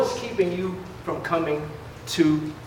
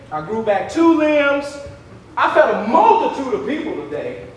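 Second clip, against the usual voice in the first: boastful Christ; 8 semitones higher, 4 dB louder than usual. Pretend. Christ bragging to his disciples about the day's miracles, hammed-up boasting. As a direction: play Christ showing off to his buddies. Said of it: "what if Christ boasts like we boast".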